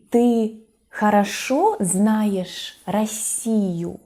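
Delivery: slow, careful reading for language learners; measured teacher's example questions and answers; slow model dialogue. Shown as a demonstration. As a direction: space the words out clearly.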